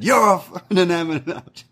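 high-pitched comeback, starting with a burst; mock retort. Imagining Wes snapping back at Waller, high mock retort. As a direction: blurt out a teasing insult.

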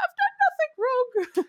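high, slow, whiny mock innocence; hammed-up innocent whine; mock innocent protest. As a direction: play the wounded innocent, drawn out. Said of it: "You're like".

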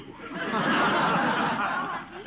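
high breathy chuckle at his own joke; enjoy the pun; self-pleased laugh. Acted. To his nephew, high self-pleased chuckle.

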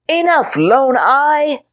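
put-on high, impatient child's shout; little knight losing patience; boyish shout. A narrator's reading. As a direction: snap at the giant like a proud boy. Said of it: "Henry shouted".

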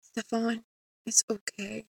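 very soft, soothing reassurance; tender near-murmur; softly said. A narrator's reading. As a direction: comfort him in a hushed voice. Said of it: "softly said".

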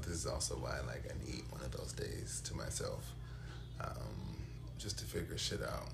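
whispering. Real, not acted. Quiet private musing.

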